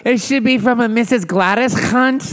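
high customer voice. Pretend. S1 plays customer.

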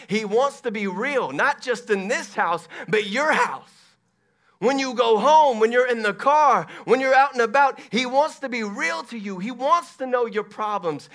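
loud, high, impassioned sermon delivery; preacher at a loud, high peak; full-force preaching. Real, not acted. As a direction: press each phrase urgently on the congregation.

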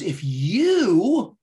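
"you" stretched out high and loud; stress on YOU. Retold or imitated. Boss correcting his employee's thinking, heavy stress on "you". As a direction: lean hard and long on "you". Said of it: "he emphasized the word you".

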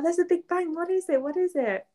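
put-on high, childlike asking; high imitated child; little kid voice. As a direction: echo the child cutely. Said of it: "that's so sweet".

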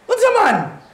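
king gasping in disbelief, pitch leaping up; amazed cry of the king; astonished king. Retold or imitated. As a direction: play the king stunned at the fourth man. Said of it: "he goes".

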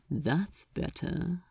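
soft, smug and satisfied. A narrator's reading. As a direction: savor the sudden quiet. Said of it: "self-satisfied smirk".

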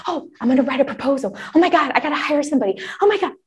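high, fast, breathless mock panic. Imitating a newbie contractor in a high, fast, frantic panic. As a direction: play the frantic newbie spiralling.

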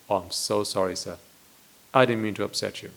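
quietly sorry to the angry beggar; humble apology. Pretend.

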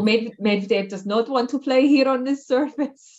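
put-on weak, hammy Russian accent; bad Russian accent. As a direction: attempt a Russian accent, badly. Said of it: "that was a really bad Russian accent".

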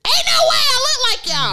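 high-pitched, incredulous protest; indignant, disbelieving cry; indignant outburst. Acted. Acted outburst at parents.

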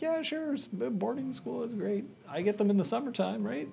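high, fast breezy mother. Retold or imitated. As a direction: chirp it like a carefree mom. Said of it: "and Mother is like".

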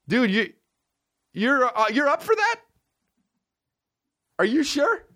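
very high incredulous question; disbelieving. Real, not acted. Comedian quizzing the poster, very high and incredulous. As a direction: ask it in total disbelief. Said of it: "incredulous questioning the poster".

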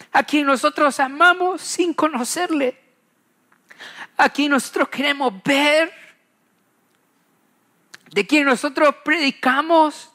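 preacher swelling with conviction; impassioned preaching. Acted. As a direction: lift them toward Jesus' coming with rising fervour. Impassioned preaching about Jesus' return.

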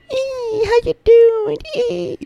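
very high squealed hello; squealing girl greeting. Pretend. Mocking girls greeting their friend with a squeal. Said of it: "I'm just kidding".

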